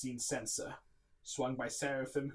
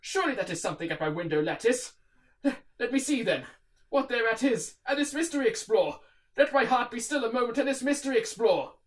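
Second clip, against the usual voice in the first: raised, higher, fast at first; nervous urgency; 12 semitones higher, 13 dB louder than usual. Acted. Narrator steels himself at the window, raised and urgent. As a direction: talk yourself brave, urgently. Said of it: "said I".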